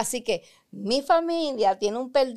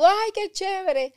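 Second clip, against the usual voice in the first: imitating a gushing thank-you, higher and slow; delighted gift reaction; 10 semitones higher, slower than usual. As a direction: gush over a surprise present. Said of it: "a ti te regalan algo de sorpresa y tú".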